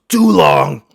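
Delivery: put-on high, loud old-man shout; mock old man yell. Barber mimicking impatient old man, loud shout. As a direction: bark it out like a grumpy old man.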